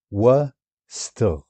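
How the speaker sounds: وستغ stretched very slowly into syllables. Shown as a demonstration. Teacher showing the syllables of وستغ, very slowly. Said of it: "syllables drawn out".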